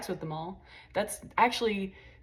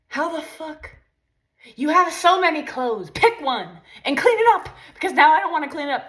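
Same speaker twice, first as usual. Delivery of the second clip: higher, louder mock rant at the mess; irritated partner; 7 semitones higher, 8 dB louder than usual. Partner seeing clothes everywhere, ranting at girlfriend: mock annoyed outburst. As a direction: imitate an annoyed partner snapping about clothes. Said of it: "you're like".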